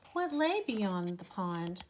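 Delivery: ordinary delivery, nothing marked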